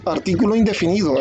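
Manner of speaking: ordinary talk, nothing marked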